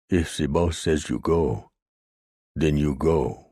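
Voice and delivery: put-on menacing woodcutter with a German accent, go becomes koh; leicht deutscher Akzent, drohend; heavy German brogue. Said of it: "He spoke with a heavy German brogue".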